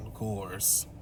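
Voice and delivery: a flat, soft "of course"; dry sarcasm. Real, not acted. Narrator scoffs at Daryl giving in, dry and soft. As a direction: roll your eyes at it.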